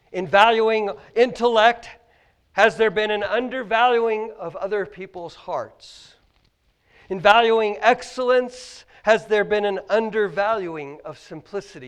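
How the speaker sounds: loud, ringing pulpit questions; preaching at full force. Acted. Pastor challenging the congregation, loud preaching. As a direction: hammer each question home to the church.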